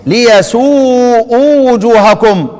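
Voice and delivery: slow recitation of ليسوء with long final oo; reading of the others. Shown as a demonstration. Teacher modelling the majority reading, slow recitation. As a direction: chant ليسوء slowly, stretching the vowel. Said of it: "عدل سما يقرؤون ايه؟".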